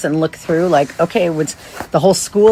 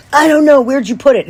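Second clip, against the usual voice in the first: put-on higher, louder petulant kid snapping back; angry kid; 8 semitones higher, 5 dB louder than usual. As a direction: play the annoyed fifth grader blaming mom. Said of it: "he is ticked at me".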